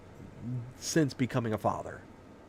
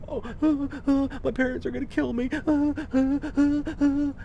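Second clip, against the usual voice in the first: higher, stammering panic, imitating his younger self; panicked teen; 14 semitones higher, 5 dB louder than usual. Sixteen-year-old after the crash, frightened, higher stammering panic. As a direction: stutter out the fear like a scared kid. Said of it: "I'm like".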